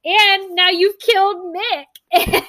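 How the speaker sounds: high-pitched mock outrage, laughing through it; shocked crowd. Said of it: "And then everyone's like".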